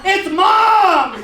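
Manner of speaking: high, loud alarmed shout; yelling. Retold or imitated. Little brother calling from the room, yelling. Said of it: "I hear my little brother yell".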